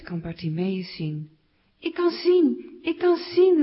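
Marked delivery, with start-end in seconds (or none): 1.8-3.6 high, loud, jubilant cry; elated, drawn-out exclamation; joyful calling out. A narrator's reading. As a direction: shout it out in delighted amazement. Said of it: "roept Bartimeus".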